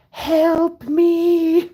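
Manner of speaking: squeaky high mock cry for help; mock plea. Mimicking the trapped guy's face begging for rescue, squeaky mock plea. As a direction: play the trapped guy begging silently.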